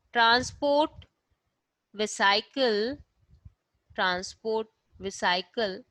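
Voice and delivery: slow, word by word for notes; slow dictation. Shown as a demonstration. Teacher dictating notes to her student, slowly. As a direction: dictate each word so students can write. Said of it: "लिख देती हूँ".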